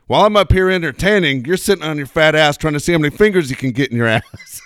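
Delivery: loud, high comic comeback; imitated heckler put-down. Dad quoting his comedian son scolding a heckler, loud mock put-down. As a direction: fire it back like a comic on stage.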